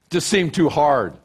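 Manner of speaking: loud, slow laughing shout; laughing mock excuse. Real, not acted. Pastor mocking a lazy excuse, loud laughing.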